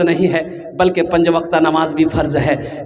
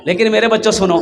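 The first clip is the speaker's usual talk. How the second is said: ordinary talk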